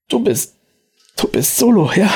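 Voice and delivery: deutlich höher, ängstlich stockend; er stammelt ungläubig. Acted. Verletzter Mann erkennt Solo. (ängstlich und viel höher)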